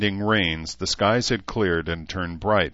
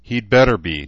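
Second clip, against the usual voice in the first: bitterly; 3 semitones higher, 8 dB louder than usual. A narrator's reading. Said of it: "Danae said bitterly".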